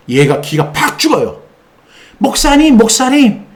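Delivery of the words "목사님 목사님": high wheedling demon voice; cowering demon begging the pastor; whiny demon. Retold or imitated. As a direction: plead up high like a cowed spirit.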